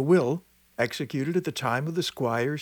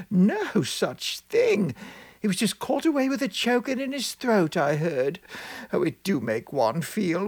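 put-on chattering old village woman; 5 semitones higher than usual. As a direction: voice an excitable old countrywoman. Same woman continues gossip.

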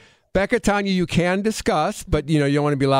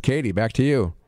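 ordinary talk, nothing marked

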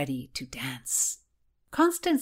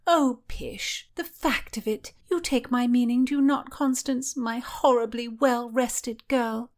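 pitched-up chatty lady-in-waiting; Mary's voice; 7 semitones higher, 3 dB louder than usual. Acted. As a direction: beckon her in a lilting high voice. Mary's teasing reply.

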